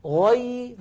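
high chanted "غي" with held ya; equal-length ya. Shown as a demonstration. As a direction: linger on the ya of "غي". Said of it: "نفس الطول". («غي» بياء ساكنة جارية)

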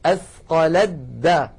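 "أثقلت دا" with a doubled د and no ت; دال مشددة بلا تاء; merged dal. Shown as a demonstration. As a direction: say "أثقلت دا" as athqaladdā. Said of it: "لاحظوها".

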